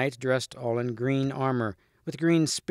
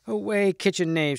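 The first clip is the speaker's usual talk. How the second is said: higher-pitched scornful cry; a lady's high, mildly raised cry; 7 semitones higher, 3 dB louder than usual. A narrator's reading. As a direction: cry it out high, sending a servant off. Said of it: "she cried".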